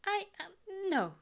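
high halting stutter. A narrator's reading. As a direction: stumble over the words. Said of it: "Aziraphale stutters".

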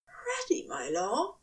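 whispered, strained question; breathy and strained. A narrator's reading. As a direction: ask it breathless and tight. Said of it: "his voice breathy and strained".

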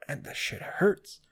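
ordinary talk, nothing marked

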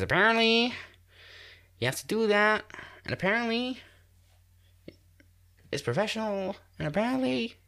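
high-pitched imitating the Apparently Kid; Apparently Kid impression. Podcaster imitating the famous news interview kid, high and excited. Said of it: "If you haven't seen that video".

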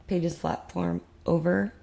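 normal talking voice

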